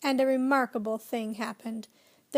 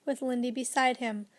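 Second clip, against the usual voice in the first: plain reading voice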